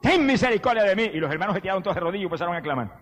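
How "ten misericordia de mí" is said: trumpet-like plea. Retold or imitated. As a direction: cry out to Jesus for mercy, mock-acted. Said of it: "levantó la voz como una trompeta".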